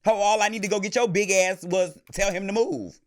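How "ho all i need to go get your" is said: loud, heated retort to security; angry yelling. Retold or imitated. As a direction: yell it, end of discussion. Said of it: "I'm like".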